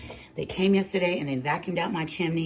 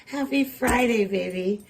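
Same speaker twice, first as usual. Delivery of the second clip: stretched greeting; 8 semitones higher, much slower than usual. Real, not acted. Greeting viewers, drawn out.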